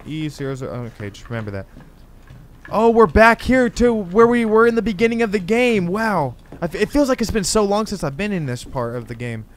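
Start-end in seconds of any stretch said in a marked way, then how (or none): 2.7-6.3 surprised recognition. Real, not acted. Recognizes the game's opening area.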